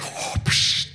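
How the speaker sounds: mimicked mechanical breathing; Darth Vader. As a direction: breathe in and out like a masked villain. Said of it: "can we talk like that".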